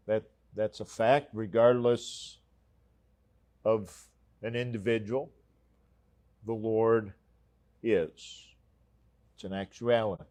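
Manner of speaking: normal talking voice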